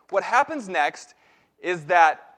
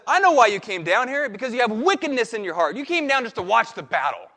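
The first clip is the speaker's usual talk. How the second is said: accusing; 4 semitones higher, 6 dB louder than usual. Retold or imitated. Brother accuses David of wickedness.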